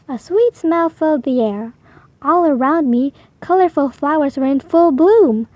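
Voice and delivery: usual speaking voice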